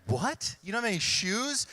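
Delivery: playing the shocked parent, pitch up; a hint of a panicky baby boomer dad; baby boomer mode. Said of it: "I go right into, like, baby boomer mode".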